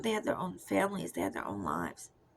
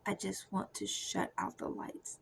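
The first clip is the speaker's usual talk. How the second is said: softer, breathy whispered quote of hopelessness; whispered despair; 8 dB quieter than usual. Retold or imitated. Quoting her despairing younger self, a soft whisper. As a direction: barely voice the wish to give up. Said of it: "I was just like".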